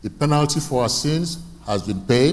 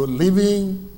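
preaching peak; 8 semitones higher, 5 dB louder than usual. Shown as a demonstration. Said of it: "preaching peak".

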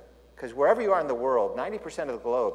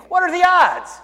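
amazed; 13 dB louder than usual. Retold or imitated. Joke character amazed at synagogue.

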